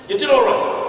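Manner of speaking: high-pitched echo of faint praise; mock-indignant repeat. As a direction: throw the words back in a high voice. Repeats pastor's faint praise incredulously.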